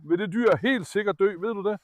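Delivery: ordinary talk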